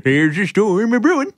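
high, loud creaky mock voice; a creaky old voice foretelling a storm; hammed-up bones voice.

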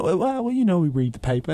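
lightly put-on bad British accent; a hint of a bad UK accent; terrible British accent. Briton answering an embarrassed American visitor, mock British accent. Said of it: "that was a terrible accent".

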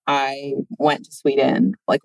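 usual speaking voice

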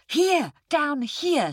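slow, emphatic tiger pointing it out; impatient tiger. Acted. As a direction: drag it out for a slow-witted listener. Tiger shows the pit.

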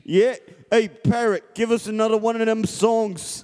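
loud, high British sailor voice; boisterous British sailor calling out. Acted. Sailor urging his parrot to sing, loud British accent. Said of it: "You're a British sailor".

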